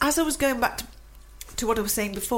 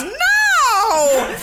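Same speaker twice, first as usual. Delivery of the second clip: retold scream; 9 semitones higher, 7 dB louder than usual. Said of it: "she slams her hands down on the desk and screams".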